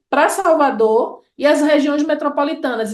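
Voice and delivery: usual speaking voice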